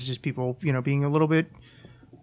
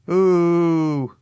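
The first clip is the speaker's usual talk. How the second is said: boo; 3 semitones higher, 6 dB louder than usual. Real, not acted. Booing the show Westworld.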